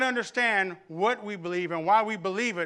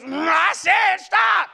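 slow, high, much louder shout of a fed-up mom; mom's furious shout; 12 semitones higher, 12 dB louder, much slower than usual. Retold or imitated. Preacher imitating his mom finally losing it with noisy kids, slow high furious shout. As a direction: drag out every word and roar it. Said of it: "turns into somebody I've never seen before".

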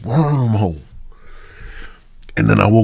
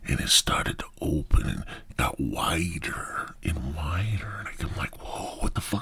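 awed whisper, fading softer; 6 semitones lower, 10 dB quieter than usual. Retold or imitated. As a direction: hush it like a secret reveal. Door slowly opening in dark.

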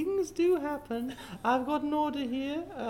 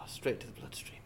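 low whispered aside; whispering; 10 dB quieter, faster than usual. Acted. Drink caught.

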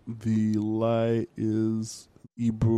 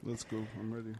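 whisper; 15 dB quieter than usual. Real, not acted. Whispered eager reply.

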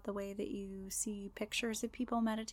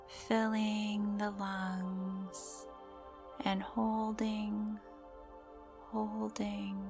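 guided meditation; slower than usual. Acted. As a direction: gently guide the listener into calm.

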